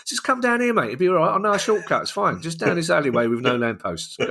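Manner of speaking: high, coaxing mock friendly lure; lightly sinister mock welcome; mock Millwall fan. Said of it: "an invite to a Millwall game from Millwall".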